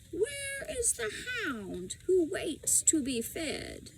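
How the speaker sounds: put-on a frail old woman chanting a warning; cracked old woman. A narrator's reading. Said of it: "in a cracked voice".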